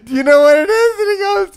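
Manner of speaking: high, loud, laughing through the quote; laughing while telling. Real, not acted. Friend retelling a funny video, laughing. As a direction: crack up mid-story.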